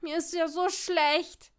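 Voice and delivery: loud, slow moan of nausea; whining. A narrator's reading. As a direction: whine miserably about feeling sick. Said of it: "jammerte Seamus".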